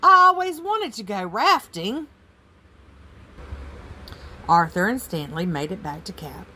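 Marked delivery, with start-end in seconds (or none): 0.0-2.1 high, loud delight; gleeful, high-pitched exclamation; excited cry. Acted. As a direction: cry out thrilled on the ride. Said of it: "cried Arthur".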